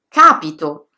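capito stressed on the first syllable, CA-pi-to; stress on the antepenultimate. Shown as a demonstration. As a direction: hit the opening CA of capito. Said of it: "sulla terzultima".

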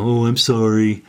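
offhand, throwaway "Oh, I'm sorry"; brush-off apology. Shown as a demonstration. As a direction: toss off the apology as if it hardly matters. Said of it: "just kind of a brush off".

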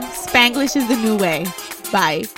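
ordinary talk, nothing marked

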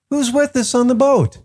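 high-pitched anxious realization; acted alarmed question. Disciples wondering who is aboard, in acted alarm. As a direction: ask it high, as a panicked disciple would.

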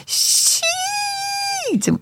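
very high drawn-out squeal; worked-up boy. Retold or imitated. Mother demonstrates her son's cry to the translator, high and excited. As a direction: imitate an overexcited kid, stretching the word. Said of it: "our son gets really excited. He's all worked up".

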